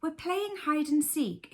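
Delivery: a lightly voiced teddy under a cushion; Bramwell Brown voice. A narrator's reading. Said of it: "in a Bramwell Brown sort of voice".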